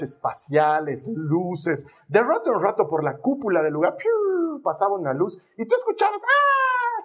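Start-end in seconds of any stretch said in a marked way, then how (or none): 4.0-4.6 high "piu" of a passing light; imitated zooming whistle. Telling of a light crossing the dome, high imitated zoom. 6.2-7.0 high drawn-out "aah"; imitated crowd scream. Telling what was heard in line, high mock scream. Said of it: "gente gritando".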